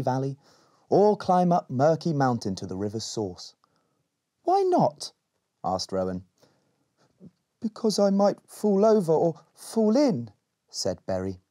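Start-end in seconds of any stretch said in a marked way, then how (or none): 4.4-5.1 put-on curious girl. Rowan questions Berry. 7.6-10.3 put-on timid boy. Timid Berry explains.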